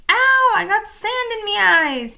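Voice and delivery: high, loud, slow pirate whine; pirate complaining about sand in his eyes; whined. A narrator's reading. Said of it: "Pinky whined".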